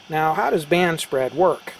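ordinary talk, nothing marked

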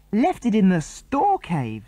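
high, slow disbelieving echo; incredulous. A narrator's reading. The others to Tom, high incredulous echo. Everyone dismayed by Tom's news.

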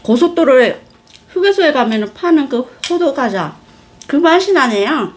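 chewing while talking; muffled by food; mouth full. Real, not acted. Seen 1: Bit pastry at -5; jaw and cheeks keep working while speaking.

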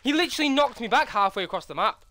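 high, loud panicked shrieks; panicking gamer. Real, not acted. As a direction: freak out as you die. Dying in game, shrieking.

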